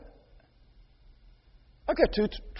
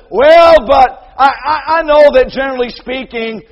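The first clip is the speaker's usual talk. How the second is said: loud, higher shouted self-justification; mock loud excuse; 8 semitones higher, 13 dB louder than usual. Mocking excuse of listener.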